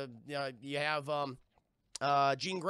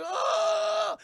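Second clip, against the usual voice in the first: a much higher, loud scream; pained scream; 14 semitones higher, 9 dB louder than usual. Retold or imitated. Tudyk screaming as his body falls to pieces. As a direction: cry out as the body comes apart. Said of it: "He's like".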